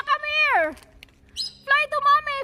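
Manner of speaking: ordinary talk, nothing marked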